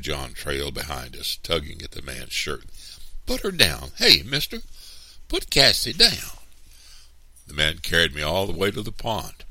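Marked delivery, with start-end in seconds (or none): 3.2-6.4 put-on loud, high-pitched insistent child; child's demand. A narrator's reading. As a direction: call out to get your sister released. Child pleads for sister's release.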